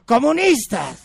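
high, loud shout; multitud que grita. Acted. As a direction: answer as a whole crowd would. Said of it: "Y gritan". (grito agudo de multitud)

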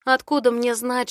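ordinary delivery

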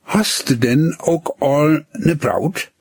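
a lightly voiced girl asking gently; cautious question. A narrator's reading. Girl gently asks the boy about a sweetheart. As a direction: ask carefully, curious. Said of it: "fragte sie behutsam".

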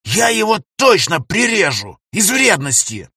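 high, slow angry hiss; hissed threat. Acted. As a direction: spit the threat out. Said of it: "прошипел Сева".

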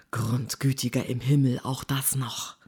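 low, grumbled aside; grumbling. A narrator's reading. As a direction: grumble it under your breath, falling away softly. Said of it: "Featherston murrte". (leise vor sich hin murrend)

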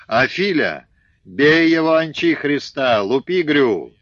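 high loud shout for help; desperate yell. Acted. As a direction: yell at your brother to hit him. Son wrestling father, calls brother.

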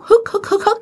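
high-pitched mock sobs in short catches; imitated sobbing hiccups. Storyteller imitating great-grandmother crying behind the kitchen, mock sobbing. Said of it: "Mà bà cố khóc nha các bạn".

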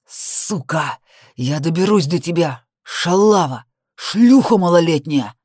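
put-on drunken cop raging, slurred and loud; town drunk. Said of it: "голос пьяного мента".

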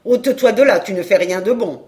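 loud, contemptuous dismissal; rough brush-off. Acted. Jealous journeyman shoos the apprentice away, loud and rough. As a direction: push him aside with your voice.

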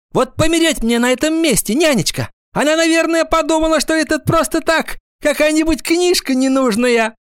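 very high, earnest schoolgirl chatter; agitated. A narrator's reading. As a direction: swear it's true, all worked up. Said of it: "волнуясь, заговорила Гвоздёва". (волнуясь, тоненьким голосом)

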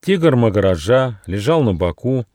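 plain reading voice

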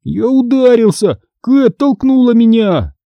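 put-on high, whiny child's complaint; hurt little boy, drawing out the end; whimpering. A narrator's reading. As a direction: tattle tearfully on your sister. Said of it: "Он морщился и хныкал".

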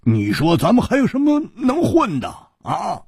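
high-pitched comic indignation; exasperated griping. Acted. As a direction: complain in wounded disbelief. Comic griping after being outdone.

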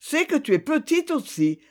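fermière qui répond. A narrator's reading. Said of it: "répondit la fermière".

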